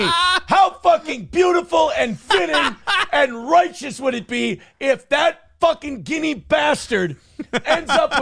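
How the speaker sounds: high laughing gloating shout; gleeful shout. Real, not acted. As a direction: crow over your rival's downfall. Gloating over rival's legal trouble.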